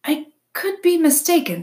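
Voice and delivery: high, loud, hooty owl voice; owl character. A narrator's reading. Owl answers the groundhog's question, high and dramatic. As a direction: lift it high like a fussy owl.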